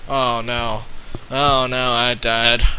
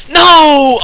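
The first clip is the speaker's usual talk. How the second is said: loud yelp; 13 semitones higher, 11 dB louder than usual. Real, not acted. Cell eaten suddenly.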